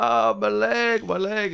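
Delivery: high, slow whining wail over her leg; pained woman's wail. Retold or imitated. Drugged woman begging a stranger at the bus, slow high pained wail. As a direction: moan it out like a woman hurting. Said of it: "she's like".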